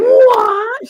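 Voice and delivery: high, loud shocked "What?"; acted high-pitched shriek of surprise; shocked outburst. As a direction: burst out in mock disbelief, pitch shooting up. Imagined outraged reaction to Izzy.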